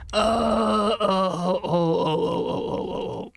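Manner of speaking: high startled oh, oh, oh trailing off; surprised cry. Real, not acted. Streamer suddenly remembering the mod, startled cry. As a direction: yelp at the sudden thought, then fade to breath. Sudden realisation, forgot something.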